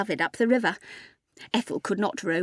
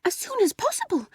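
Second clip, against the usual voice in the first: very high, airy reply; Ethel's lovestruck voice; 11 semitones higher than usual. A narrator's reading. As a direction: answer in a high, half-whispered voice. Ethel accepts marriage, eyes closing.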